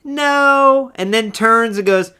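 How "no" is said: mock sweet high "oh, no"; dismissive Ariana. Retelling Ariana's snub of Thea, mock sweet higher dismissal. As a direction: toss off a higher, louder brush-off. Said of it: "Ariana turns her head ... and goes".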